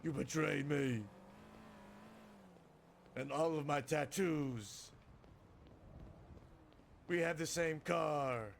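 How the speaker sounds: loud, slow, drawn-out wail of betrayal; mock betrayed outrage. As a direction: stretch each line like soap-opera heartbreak. Acts out betrayed Vinny.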